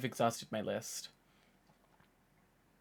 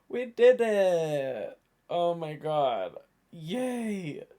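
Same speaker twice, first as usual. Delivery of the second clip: tired but triumphant cheer; celebrating; 3 semitones higher, 4 dB louder, much slower than usual. Real, not acted. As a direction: savour the finish line. Celebrating hitting twelve hours.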